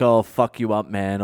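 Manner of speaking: ordinary delivery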